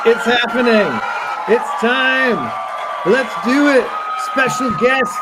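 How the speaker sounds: big high hype-man shout. Acted. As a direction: pump up the crowd, yelling. Hyping audience before guest reveal.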